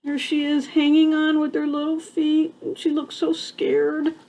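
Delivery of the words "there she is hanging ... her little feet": ordinary delivery, nothing marked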